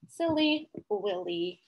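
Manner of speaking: ordinary delivery, nothing marked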